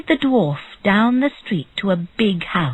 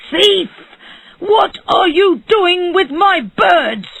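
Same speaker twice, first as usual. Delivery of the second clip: high, loud, drawn-out shout; angry shout; 12 semitones higher, 5 dB louder, slower than usual. A narrator's reading. Bird seller confronts the dwarf among his birds, shouting. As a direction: shout it as an accusation. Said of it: "he shouted".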